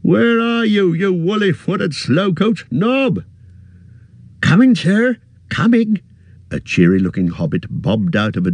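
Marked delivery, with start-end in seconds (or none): 0.0-3.2 high, impatient shout for the servant; impatient calling. A narrator's reading. Innkeeper scolds slow Nob, calling high. 4.4-6.0 high, louder, quick reply from off; eager servant. A narrator's reading. Young servant Nob answers the landlord eagerly.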